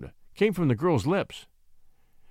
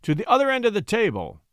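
high commanding cry; 6 semitones higher, 3 dB louder than usual. A narrator's reading. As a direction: order him across the room. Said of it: "he cried".

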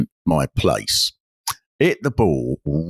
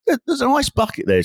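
exasperated plea. Retold or imitated. Pointing out the bucket.

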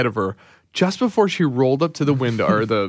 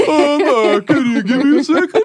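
high, panicky voice at the speaker; flustered customer voice; 14 semitones higher, 5 dB louder than usual. Retold or imitated. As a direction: squeak it out like a lost driver. Mock flustered customer at window.

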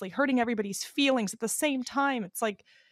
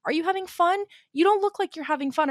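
pushy concerned friend; 8 semitones higher, 3 dB louder than usual. Retold or imitated. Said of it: "someone's like".

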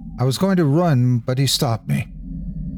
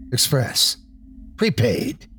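lightly voiced unnatural grunter; 2 semitones higher, slower than usual. A narrator's reading. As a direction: grunt it out, a touch inhuman. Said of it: "grunted in a highly unnatural voice".

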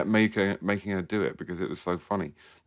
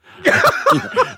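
big high laugh; 13 dB louder than usual. Real, not acted.

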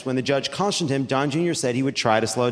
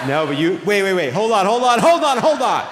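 building louder and higher with each repeat; urgent interruption; 4 semitones higher, 5 dB louder than usual. Real, not acted. Host halts an audience disputing his pronunciation, escalating urgency. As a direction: cut the crowd off, escalating to high and loud.

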